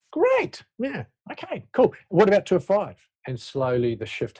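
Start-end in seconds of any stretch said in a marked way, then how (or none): 0.1-3.1 high, upbeat coaching reply; acted enthusiasm, pitched up to nudge them onward; encouraging coach. Acted coach cheering a small step forward.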